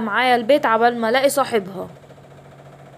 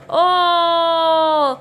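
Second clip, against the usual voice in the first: long drawn-out groan of transformation; 4 semitones higher, much slower than usual. Acted. Injected, transforms.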